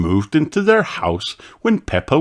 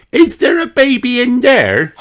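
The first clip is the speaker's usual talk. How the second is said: put-on high, louder child question; asking; 5 dB louder than usual. A narrator's reading. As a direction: ask innocently like a little girl. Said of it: "she asked".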